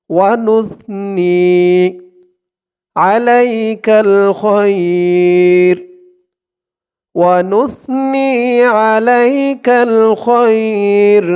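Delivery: measured teaching recitation; slow devotional recitation. Shown as a demonstration. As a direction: recite each phrase slowly for repetition. Recitation continues.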